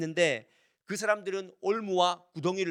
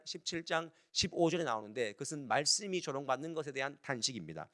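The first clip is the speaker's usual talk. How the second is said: ordinary talk, nothing marked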